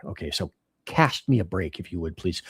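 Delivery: ordinary talk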